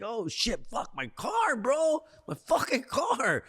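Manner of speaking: high-pitched wail of sudden realization; retold dismay. Four shots in, remembering the abandoned car, mock anguished dismay. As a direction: moan it upward, drawing out the last words.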